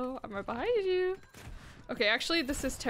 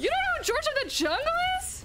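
high, loud, outraged disbelief; shocked disbelief; 4 dB louder, faster than usual. Real, not acted. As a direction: squeal it in utter disbelief. Shocked friend doesn't know.

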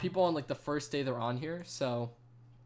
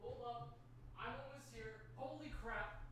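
distant, much quieter voice off-mic; far off; 7 semitones higher, 26 dB quieter than usual. Real, not acted. Streamer leaving the room and returning, far off. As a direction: call from across the house, higher and faint.

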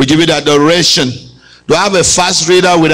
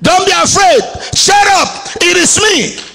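higher, shouted promise to the listeners; shouted proclamation; 12 semitones higher than usual. Acted. Preacher telling the church God's words, a shouted promise. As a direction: announce it to the whole room. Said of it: "God is saying unto you".

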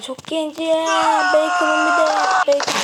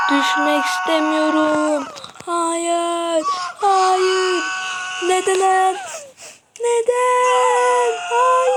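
panicked wail; 7 semitones higher, much slower than usual. Real, not acted. Gamer wailing as his character falls, high panicked cries. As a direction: wail in panic as you fall.

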